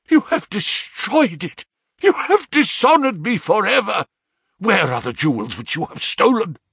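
high-pitched raging accusation; beside himself with rage. A narrator's reading. Father accuses son of ruining the coronet, raging shout. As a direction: rage at him, voice pitched right up. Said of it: "beside myself with rage".

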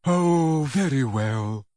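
sighing, falling concession; resigned. A narrator's reading. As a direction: give in reluctantly with a sigh. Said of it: "he said, looking resigned".

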